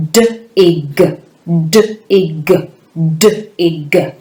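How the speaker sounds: "dig" broken into d, i, g; "dig" as separate little sounds with gaps; segmented sounds. Shown as a demonstration. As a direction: sound out "dig" one phoneme at a time. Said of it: "we will segment it".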